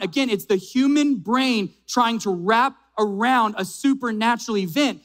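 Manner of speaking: ordinary delivery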